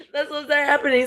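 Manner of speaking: high words still shaking with laughter; laughing. Real, not acted. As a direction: laugh into the line. Laughing at God story.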